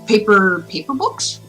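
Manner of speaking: plain reading voice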